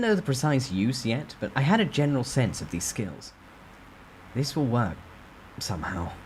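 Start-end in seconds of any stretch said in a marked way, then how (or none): none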